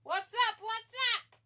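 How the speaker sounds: high, loud call-out; loud repeated wrestler's catchphrase; catchphrase shout. Acted. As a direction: shout it like a fan chanting along.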